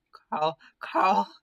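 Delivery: loud, high, suddenly hopeful calls. Acted. Raven hearing his friends and calling Carl hopefully. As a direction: call out with sudden hope. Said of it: "voice is instantly turned hopeful".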